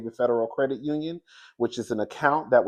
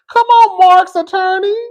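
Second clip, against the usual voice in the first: loud, high cheering; excited cheer; 16 dB louder than usual. Real, not acted. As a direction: cheer him on loudly. Cheering lawyer's statement.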